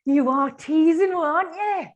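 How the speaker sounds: loud, high, slow squeal; excited outcry. Real, not acted. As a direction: drag it out in delight. Host reacts to teased gossip.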